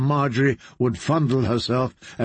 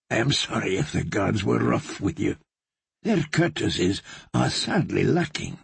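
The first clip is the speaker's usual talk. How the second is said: softer, lower, gently concerned; kind, solicitous; 4 semitones lower, 3 dB quieter than usual. Acted. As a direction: soothe him like a kindly healer. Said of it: "His voice was kind, solicitous".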